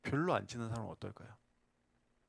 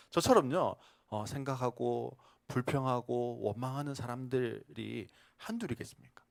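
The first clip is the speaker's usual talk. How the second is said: usual speaking voice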